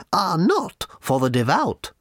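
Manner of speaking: put-on high, slow old lady; slow and prim, very high; little old lady voice. A narrator's reading. Booth lady refusing Arthur, high and slow.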